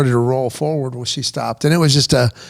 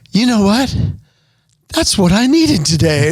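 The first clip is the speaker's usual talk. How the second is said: higher, grateful lightly voiced woman; relieved older woman; 5 semitones higher than usual. A comforted stranger thanking the driver, higher and relieved. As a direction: lift the voice in relief. Lady relieved after hug.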